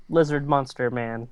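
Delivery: normal talking voice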